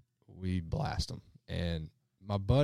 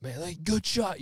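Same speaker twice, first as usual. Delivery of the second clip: high, pumped-up fake praise; a hint of a guide cheering a client's shot; mock hype-man; 10 semitones higher than usual. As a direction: hype the client up a bit too much. Imitating buddies hyping up clients.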